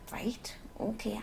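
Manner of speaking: soft whisper, slow. Real, not acted. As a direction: hush it, bedside quiet. Hushed reply at the bedside.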